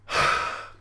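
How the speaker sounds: long exasperated sigh of a pissed-off customer; angry posh sigh. Retold or imitated. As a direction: sigh like a furious rich shopper. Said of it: "that noise that I just made".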